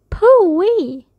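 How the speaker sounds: high, drawn-out exclamation at a stink; mock disgust. A narrator's reading. Daddy picking up smelly Rabbit, mock disgust.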